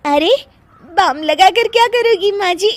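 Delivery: high laughing voice, giggles through the words; can't stop laughing while offering help; high giggly bahu voice; giggling. Acted.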